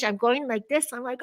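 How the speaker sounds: ordinary talk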